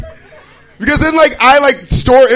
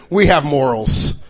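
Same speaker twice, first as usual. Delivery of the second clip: lower, slow smug tone; lightly mocking kid; 6 semitones lower than usual. Kid explaining the rule to the comic, smug and slow. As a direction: say it smugly, slowly. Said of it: "Like, Well".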